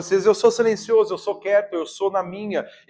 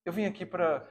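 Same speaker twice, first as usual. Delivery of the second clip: intimate prayer; 8 dB quieter than usual. Acted. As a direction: pray quietly as if alone with God. Leads a spoken prayer.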